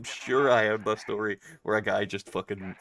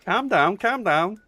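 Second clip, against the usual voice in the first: mock calm down; 11 semitones higher, 6 dB louder, slower than usual. Mock scolding after accent teasing.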